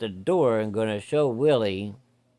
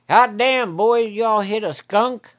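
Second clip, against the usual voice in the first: higher, much louder shocked holler; surprised yell; 11 semitones higher, 8 dB louder, slower than usual. Retold or imitated. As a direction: bellow it out as you run to the door. Willie shouting at the boys.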